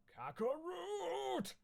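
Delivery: high, loud, slow bellowed name; a long loud hammed-up war cry; loud roar. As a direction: drag the name out in a furious yell. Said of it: "said Broly loudly".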